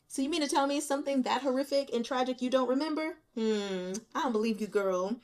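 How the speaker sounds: ordinary talk, nothing marked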